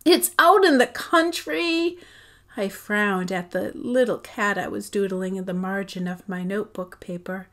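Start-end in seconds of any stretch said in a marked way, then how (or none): 0.1-2.0 high, loud, shocked cry; dismayed outburst. A narrator's reading. Girl dismayed by the move, crying out in shock. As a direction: blurt it out in dismay.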